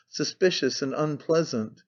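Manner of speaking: ordinary delivery, nothing marked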